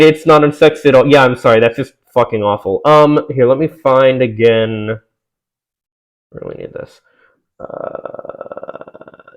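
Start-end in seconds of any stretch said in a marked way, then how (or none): 6.3-9.3 whisper. Real, not acted. Aside while searching for text.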